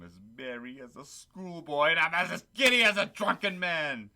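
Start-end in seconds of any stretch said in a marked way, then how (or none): 1.9-4.1 giddy shout. Acted. Scrooge shouting joyful greetings.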